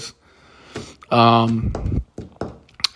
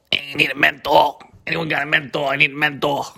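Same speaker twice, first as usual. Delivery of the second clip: raised, strung-out craving voice; crazy person voice; 3 semitones higher than usual. Retold or imitated. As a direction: beg for a menthol like a desperate crazy person. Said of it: "acting like a crazy person that needs a menthol".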